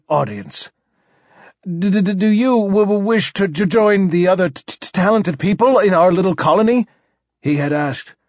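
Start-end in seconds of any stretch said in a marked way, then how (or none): 1.6-6.9 higher, heavily stammering monarch; stuttering king. Acted. King invites newly arrived poet to join, stuttering. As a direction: stumble over the first sound of words.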